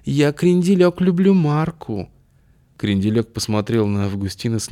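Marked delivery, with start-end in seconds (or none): 0.0-2.1 higher, louder, slow mincing show-off voice; affected club slang. Acted. Augustin trying to pass as a club regular, mincing high drawl. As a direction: camp it up, drawn out and high. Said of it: "стараясь кривляться как можно естественнее".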